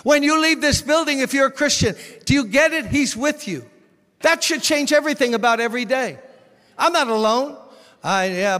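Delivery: loud, high, ringing sermon voice; fiery pulpit preaching; full-force preaching. Acted. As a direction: preach it out to the whole room.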